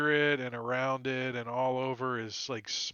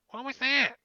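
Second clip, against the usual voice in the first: high, loud startled goblin voice; a goblin jumping at a noise; high squeaky what was that; 12 semitones higher, 6 dB louder than usual. Acted. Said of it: "this guy's like".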